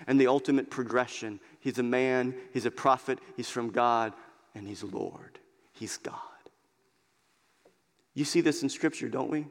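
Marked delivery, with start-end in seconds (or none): 4.5-6.1 whispered, much softer, reverent. Real, not acted. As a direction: drop to a whisper for the final truth. Climax of the sermon point.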